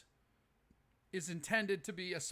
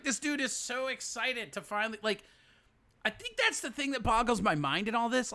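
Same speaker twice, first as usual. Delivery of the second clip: ordinary talk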